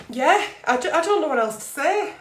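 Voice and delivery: fighting back tears mid-sentence; voice breaking. Real, not acted. Vlogger to Australian followers, voice breaking with emotion. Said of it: "sorry for getting emotional".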